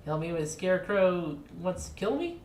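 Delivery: normal talking voice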